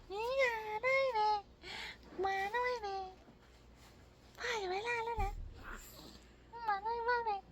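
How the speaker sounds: high, soft sing-song cooing. Real, not acted. Father saying goodbye to his baby before leaving, high soft cooing.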